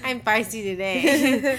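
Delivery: slow, laughing and loud. Real, not acted. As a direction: laugh through the words. Laughing at own mood.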